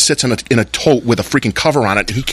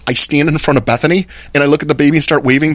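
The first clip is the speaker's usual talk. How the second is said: ordinary talk, nothing marked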